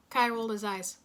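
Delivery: normal talking voice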